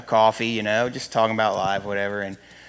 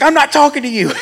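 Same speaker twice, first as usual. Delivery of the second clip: mom snapping; 10 dB louder than usual. Retold or imitated. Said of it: "And she's like".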